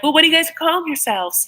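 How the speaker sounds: high-pitched attempt at an English accent; bad English accent. Sister quizzing the couple on their label, badly imitated English accent. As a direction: lift the voice into a poor English accent. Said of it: "I can't do this accent".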